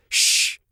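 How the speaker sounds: firm "shh" hush; father's urgent shush. Acted. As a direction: hiss for silence. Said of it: "held a finger up to his lips".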